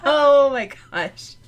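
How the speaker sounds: ordinary delivery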